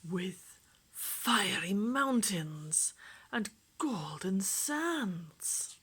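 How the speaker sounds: plain reading voice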